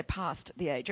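long broad Australian A in PAST; Australian accent. Shown as a demonstration. Preacher showing the congregation how Australians say it, Australian accent. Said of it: "'cause we say".

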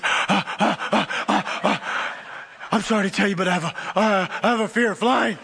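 panting, then gasping out words; mock breathless confession broken by gasps; hyperventilating. Panicked passenger confessing her fear, imitated hyperventilating.

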